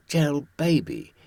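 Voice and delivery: ordinary talk, nothing marked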